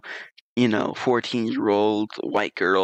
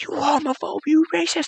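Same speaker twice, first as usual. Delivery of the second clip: very high mocking shriek; very high sarcastic imitation; mock shrieking girls. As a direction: squeal it like an offended teen. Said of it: "And he's like".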